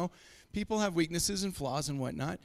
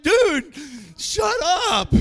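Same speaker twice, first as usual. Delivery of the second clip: higher and louder, a playful yell; mock outburst; 10 semitones higher, 10 dB louder, much slower than usual. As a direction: snap it at your buddy, hammed up. Retold outburst at a friend.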